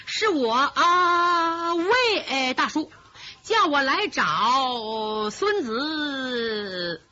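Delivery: very slow, stretched stammer; playing dumb with a mock stammer; fake stutter. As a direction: drag it out and stumble on each word. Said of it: "又结结巴巴地说".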